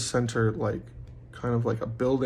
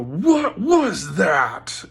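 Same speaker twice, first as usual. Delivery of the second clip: loud, drawn-out yell with raised pitch; deep subhuman roar; 7 semitones higher, 6 dB louder, much slower than usual. Retold or imitated. Speaker re-enacting his yell at the girl, subhuman roar. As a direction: bellow each word slowly with righteous might. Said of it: "this, like, deep subhuman".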